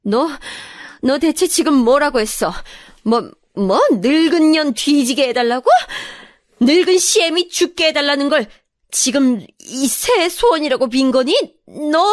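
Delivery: mother-in-law aghast, pitched high; trembling outrage. Acted. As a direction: demand answers in rising, indignant outrage. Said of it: "시어머니는 부들부들 떨면서".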